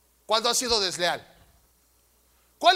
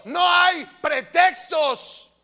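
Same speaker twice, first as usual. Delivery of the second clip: slow, loud shout on "No hay pretextos" with a strong fall; 5 semitones higher, 5 dB louder, much slower than usual. Real, not acted. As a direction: bellow "No hay pretextos" at the congregation. Shouted, later repeated hushed. (el pastor grita a la congregación "No hay pretextos")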